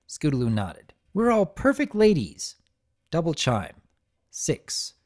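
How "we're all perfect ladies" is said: high filly voice. Acted. Voices Scootaloo.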